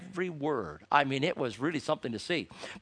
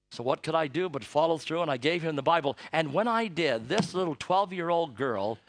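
ordinary talk

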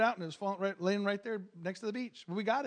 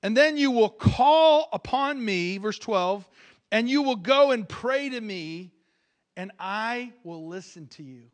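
scripture reading; 7 dB louder, slower than usual. Acted. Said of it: "but He says this".

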